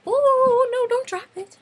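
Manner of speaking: very high, squeaky fretting; high-pitched alarm. Real, not acted. Fan fumbling her new shirt, squeaky alarm. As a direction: squeal as if it's slipping from your hands.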